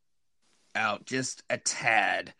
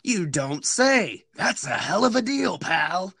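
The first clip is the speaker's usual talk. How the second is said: cheesy salesman voice; 5 semitones higher, slower than usual. Pretend. Hammy reply to the price.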